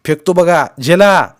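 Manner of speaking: high, loud call to catch; shouted warning. A narrator's reading. Boy about to throw the bundle, shouting. Nephew warns before throwing.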